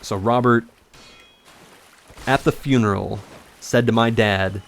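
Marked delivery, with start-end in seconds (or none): none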